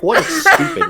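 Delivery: high, giggling protest; giggly high-pitched objection; laughing protest. Retold or imitated. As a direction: laugh through the words in disbelief. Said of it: "I was like".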